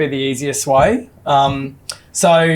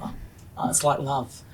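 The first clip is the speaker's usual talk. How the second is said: mock hushed awe; 11 dB quieter than usual. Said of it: "And I was like".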